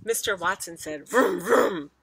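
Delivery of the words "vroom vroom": car engine noise. A narrator's reading. Father revs a dream car in his sleep, engine noise. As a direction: rev the engine twice, loudly. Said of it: "dreaming of driving a very fast car".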